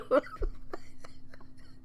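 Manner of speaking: silent, airy wheezing laugh; breathless wheeze laugh. Real, not acted. Still laughing.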